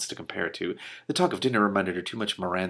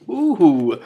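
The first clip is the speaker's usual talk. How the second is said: higher, louder gleeful ooh; excited ooh; 9 semitones higher, 12 dB louder than usual. Real, not acted. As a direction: squeal at the juicy gossip. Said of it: "juicy gossip from dragons".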